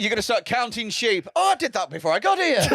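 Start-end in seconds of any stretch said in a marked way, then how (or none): 1.3-2.6 put-on barely-there Irish accent, higher; a lazy go at an Irish accent; half-hearted Irish. Cohost voicing an Irishman in a half-hearted Irish accent. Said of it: "I couldn't be asked with the accent".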